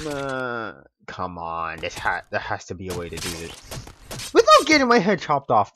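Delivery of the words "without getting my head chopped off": high, loud panicked yell; desperate shout. Real, not acted. Desperate while game character dies.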